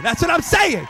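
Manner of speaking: usual speaking voice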